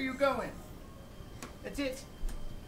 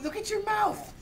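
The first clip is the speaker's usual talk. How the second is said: ordinary talk, nothing marked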